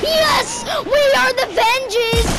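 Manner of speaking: high, loud victory cry; triumphant shout. Real, not acted. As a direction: shout the win proudly.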